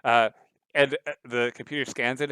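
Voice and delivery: ordinary delivery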